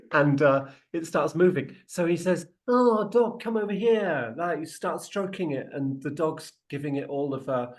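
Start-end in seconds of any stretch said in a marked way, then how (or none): 2.7-4.3 high-pitched dog-calling coo. Acted. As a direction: call a dog over in a higher, delighted voice. Rep fusses over a dog.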